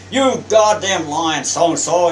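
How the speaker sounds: loud, high, slow outburst; tearing into a sailor; angry captain. Retold or imitated. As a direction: retell the captain's tirade at full volume. Captain berates young seaman for excuse.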